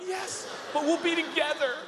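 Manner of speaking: high-pitched, gushing reply; tearful joy. Acted. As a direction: burst out overjoyed in a high voice. Mary overjoyed at reunion.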